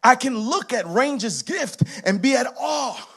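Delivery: ordinary talk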